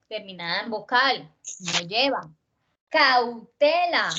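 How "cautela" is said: "Cautela" very slow, force on "te"; stress shown. Shown as a demonstration. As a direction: stretch "Cautela" syllable by syllable, leaning on "te". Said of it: "¿Dónde tiene el acento cautela?". («Cautela» muy lento, con la fuerza en «te»)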